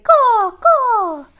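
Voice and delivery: high, loud crow caws. Shown as a demonstration. Teacher to children, cawing like a crow. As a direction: caw like a noisy crow. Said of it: "a noisy crow who might say".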